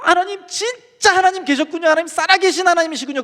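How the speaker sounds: high-pitched, overcome cry of discovery; awed outcry. Retold or imitated. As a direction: reenact crying out to God in astonishment. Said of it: "그러면서 그때부터 소리 내가지고". (높은 목소리로 벅차게 외치듯)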